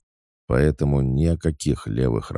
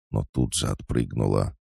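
plain reading voice

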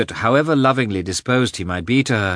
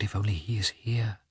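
breathy unvoiced whisper to herself; hushed inner whisper; whispered thought; 8 dB quieter than usual. A narrator's reading. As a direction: whisper it as a secret hope. Anna's anxious private thought.

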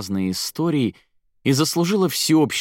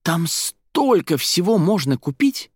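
high, slow, emphatic amazement; overexcited interrupting outburst; almost shouting; 9 semitones higher, 3 dB louder, slower than usual. A narrator's reading. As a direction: exclaim it, nearly shouting. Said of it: "он почти кричал".

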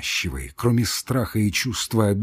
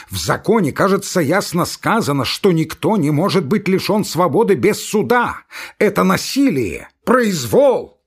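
furious tirade; 8 semitones higher, 4 dB louder than usual. Acted. Ivan rages at the locked door.

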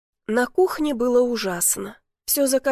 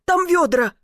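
high, urgent call; 7 semitones higher, 4 dB louder than usual. A narrator's reading. Neighbour pointing rescuer to buckets, calling out. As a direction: sing out the answer urgently.

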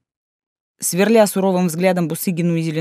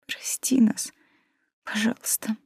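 reluctant squeeze; 6 semitones higher, 6 dB quieter, slower than usual. A narrator's reading. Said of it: "она выдавила".

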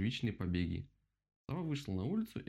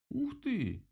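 high-pitched drawn-out wow; amazed exclamation; 13 semitones higher, 3 dB louder than usual. A narrator's reading. Rabbit watching the owl vanish underground, amazed cry. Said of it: "воскликнул кролик".

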